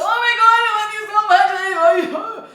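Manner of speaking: high, very loud falsetto mock moaning; shrieking over-the-top lover voice. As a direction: ham up his screaming in her ear. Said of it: "He's like".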